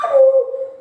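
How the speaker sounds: high, loud cry of shock; imitated scream. Young man crying out on seeing his amputated leg. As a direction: yelp as if seeing your leg gone. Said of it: "dia teriak".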